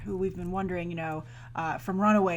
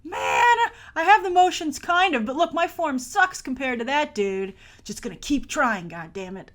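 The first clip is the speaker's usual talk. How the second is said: frustrated gym student; 5 semitones higher, 4 dB louder than usual. Retold or imitated. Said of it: "That's like me when I go to gym class".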